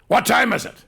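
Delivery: loud, high, impatient snap at her; angry demand. Retold or imitated. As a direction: demand the time like a jealous partner. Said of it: "And he goes".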